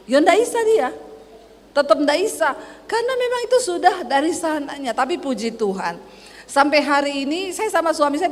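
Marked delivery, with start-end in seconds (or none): none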